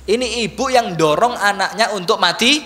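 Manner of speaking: usual speaking voice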